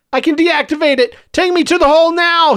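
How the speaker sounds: high, urgent plea-like scream; villain screaming up at his captor; screamed demand. A narrator's reading. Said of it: "he screamed up at Joseph".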